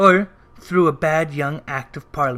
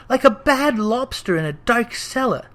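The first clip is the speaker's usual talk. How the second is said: plain reading voice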